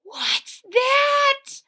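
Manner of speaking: very high frightened cry; startled by a rumble; scared. Acted. Leaves hear rumbling, afraid.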